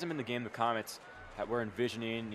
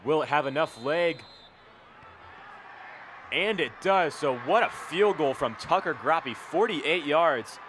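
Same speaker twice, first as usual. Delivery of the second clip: big play-by-play call, shouting; excited shout; 4 semitones higher, 8 dB louder than usual. Real, not acted. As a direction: yell the kick as it sails through. Live call of a long field goal.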